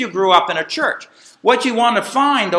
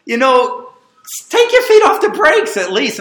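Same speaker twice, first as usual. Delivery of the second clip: exasperated call to the driver; 8 semitones higher, 6 dB louder than usual. Retold or imitated. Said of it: "I said".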